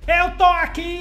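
high, slow captain calling down; yelling across the room. Acted. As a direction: call down from the walls as the captain. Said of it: "o cara tá gritando lá de cima".

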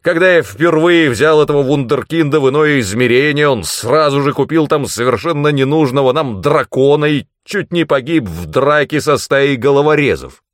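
loud, high, outraged rant; explosive tirade. A narrator's reading. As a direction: pour out the grievance at full force. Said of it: "снова взорвался мой наставник".

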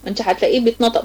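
usual speaking voice

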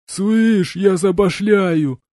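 high, loud, slow pleading; russian street thug. A narrator's reading. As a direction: beg like a desperate street punk. Gopnik begging. (гопник, в отчаянии)

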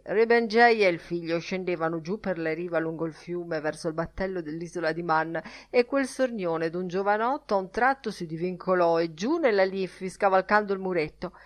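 ordinary delivery

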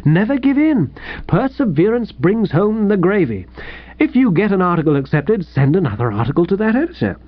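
high, hearty pep talk; cordial. Acted. Editor encourages the writer he just rejected, cordially. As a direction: urge him on with blithe good cheer. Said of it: "said Sippy cordially".

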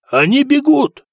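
high, loud cry of amazement; disbelieving shout. A narrator's reading. Yusuf seeing pirates flee, disbelieving shout. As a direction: shout it high, as if unable to believe. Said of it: "не веря своим глазам, прокричал Юсуф".

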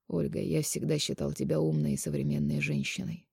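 soft, subdued disappointment; weary sigh. A narrator's reading. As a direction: speak softly, as if sighing. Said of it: "вздохнул Полонский". (тихо, со вздохом разочарования)

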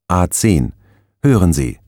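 normal talking voice

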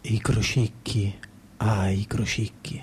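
ordinary delivery

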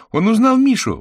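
put-on high, loud girl's cry; delighted exclamation. Vera sees the cat recognised Misha, high excited cry. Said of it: "воскликнула Вера".

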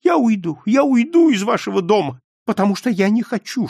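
high, loud repeated plea; frantic insistence. A narrator's reading. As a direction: insist desperately on leaving. Swede frantically repeats he'll leave. (твердить громко, отчаянно)

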